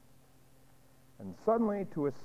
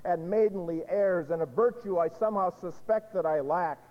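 loud, high, scornful rage of a kitchen maid; Aldonza's bitter outcry; 6 semitones higher, 5 dB louder than usual. Acted. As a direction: hurl it at him like an accusation. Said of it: "And she responds and says".

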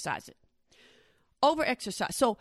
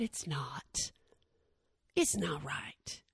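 instructor's verdict, hushed and low; whispered verdict; 9 semitones lower, 11 dB quieter, much slower than usual. Retold or imitated. Instructor quietly telling her it's wrong, whispering. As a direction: drop to a near whisper, slow. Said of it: "She said".

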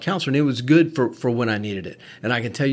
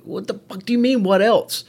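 high, loud, exasperated outburst; indignant retort, much higher and louder; pissed off; 10 semitones higher, 5 dB louder than usual. Retold or imitated. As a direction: throw it back at the doctor, voice rising high. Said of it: "I'm literally getting pissed at the guy".

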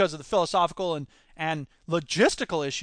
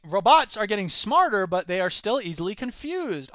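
ordinary delivery

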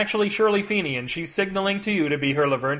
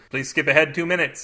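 usual speaking voice

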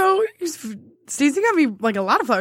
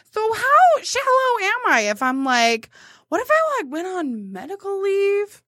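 sheepish pitch; 7 semitones higher than usual. Retold or imitated. Retold own pitch, high, laughing.

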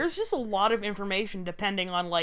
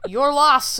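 louder brush-off with a hint of Brooklyn accent; old Brooklyn accent, higher and louder; old bus driver accent; 4 semitones higher, 6 dB louder than usual.

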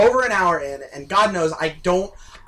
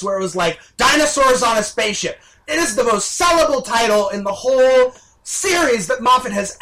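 higher, louder, heated complaining; indignant raised voice; exasperated rant; 6 semitones higher, 6 dB louder than usual. Real, not acted. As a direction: vent full frustration at the showrunner. Frustrated rant about dinosaurs.

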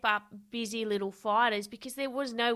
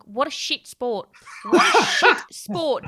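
laughing, exasperated shout; mock-furious yell building up; frustrated outburst; 4 semitones higher, 9 dB louder than usual. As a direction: blurt it out, half laughing in frustration. Frustrated outburst after sparring.

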